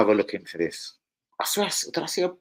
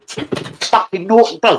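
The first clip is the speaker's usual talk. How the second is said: high slow scolding; 10 semitones higher, 7 dB louder, much slower than usual. Real, not acted. Scolding the dog.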